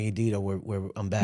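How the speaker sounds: low, hushed hey I'm back; soft greeting. Retold or imitated. Husband leaning over the sleeping patient, hushed low greeting. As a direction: lean in and murmur it gently. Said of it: "I was like".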